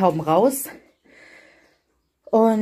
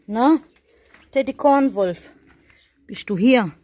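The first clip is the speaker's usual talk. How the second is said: high, louder searching call; calling a pet; 5 semitones higher, 5 dB louder than usual. Real, not acted. Speaker looking for an animal, high singsong call.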